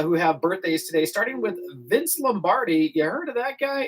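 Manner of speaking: ordinary talk, nothing marked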